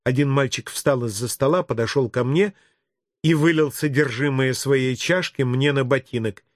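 ordinary delivery, nothing marked

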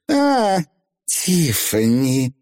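high "ah", then drawn-out name; slow, unembarrassed witch's greeting; witch teacher greeting. Acted. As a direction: greet grandly, stretching the name.

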